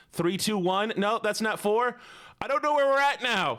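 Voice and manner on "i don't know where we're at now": exasperated laugh. Real, not acted. Chat botches the countdown.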